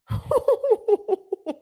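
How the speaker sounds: high, gleeful peal of laughter. Real, not acted. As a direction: crack up at someone's saltiness. Laughing at the salty man.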